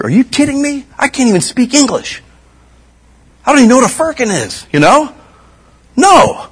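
high, mock-disbelieving outburst; incredulous. Real, not acted. Preacher scoffing at knowing Greek, high incredulous.

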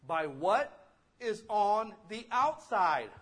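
emphatic preaching. Real, not acted. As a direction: hammer the point home slowly. Preacher driving home his point.

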